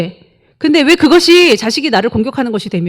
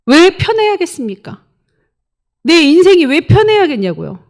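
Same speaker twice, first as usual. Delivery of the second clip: rising rebuke; 6 semitones higher, 3 dB louder than usual. Real, not acted. Preacher builds to a challenge.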